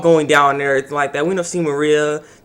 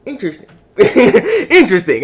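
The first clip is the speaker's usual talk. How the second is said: amused interesting; 5 semitones higher, 4 dB louder than usual. Real, not acted. Reviewer laughing at the anime's plot twist. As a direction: chuckle through the word, amused. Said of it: "that's all I can say".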